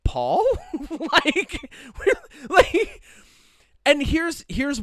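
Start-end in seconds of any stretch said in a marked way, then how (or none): 0.1-0.4 confused, with a laugh; laughing confusion. Retold or imitated. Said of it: "We all looked ... and went". 0.7-3.0 high laughing aside. Real, not acted. Laughing after joke.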